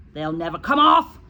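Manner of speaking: loud, firm retort; loud dismissive shout; shouting back. A narrator's reading. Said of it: "the Trunchbull shouted back".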